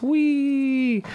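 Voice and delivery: high drawn-out mock "whee"; kid's gleeful whee. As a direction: imitate a kid having fun, long and high. Imitating kid twisting the knobs.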